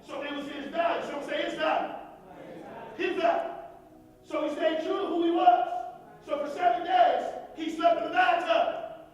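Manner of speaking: loud, high-pitched sermon delivery; fired-up preaching. Real, not acted. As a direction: drive it home to the whole room. Preaching at full force to congregation.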